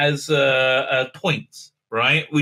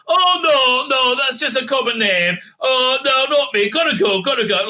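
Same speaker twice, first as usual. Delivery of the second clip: higher, louder fake-humble protest; unconvincing modesty; 7 semitones higher, 4 dB louder than usual. The Doctor denying her fame, higher and hammed-up unconvincing. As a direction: brush off praise badly, clearly fishing. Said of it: "Like, in a very unconvincing way".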